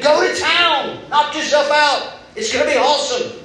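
high, bright-eyed, lavish invitation with a laugh; a thrilled host waving at a buffet; generous God, excited. Retold or imitated. Preacher voicing God telling Adam to feast, excited. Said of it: "And he said".

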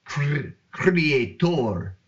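slow, drawn-out accented Cre-, creator. Shown as a demonstration. Host jokingly saying creator in an accent, slow and drawn out. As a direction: stretch out creator in a put-on accent. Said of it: "if you want to say it in an accent".

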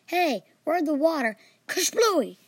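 high startled exclamation; surprised shout. Acted. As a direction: blurt it as it blows up. Teacher surprised by explosion.

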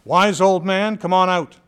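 loud call toward the cave. Retold or imitated. Boy calling the hermit out of his cave, loud. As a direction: shout it up to the mountain cave. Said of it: "I called out".

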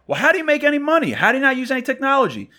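very high, incredulous phone yell; retold outburst, fired straight back. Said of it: "as soon as I raised my voice".

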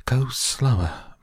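high, slow, lightly winded plea; a touch out of breath, dragging the words; mildly panting. A narrator's reading. Winded mother begging the guide to slow, mildly panting. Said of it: "panted Missus Salt".

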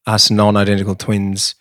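ordinary delivery

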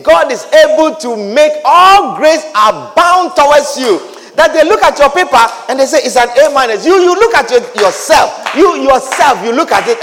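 loud, rising sermon cries on grace; preaching at full force. Acted. Preacher to his congregation, fervent loud preaching.

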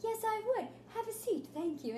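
put-on high, prim little-girl voice; proper little girl. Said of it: "she's like".

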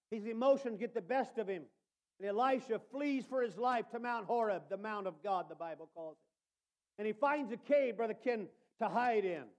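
ordinary delivery

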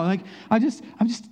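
ordinary talk

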